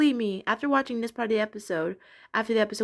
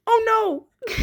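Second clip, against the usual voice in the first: much higher, louder squeal of alarm; alarmed cry; 13 semitones higher, 6 dB louder than usual. Retold or imitated. The fish realizing he's the pumpkin, in a panicked squeal. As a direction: yelp it in panic. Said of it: "and he's like".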